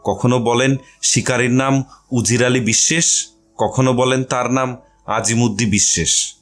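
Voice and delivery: ordinary delivery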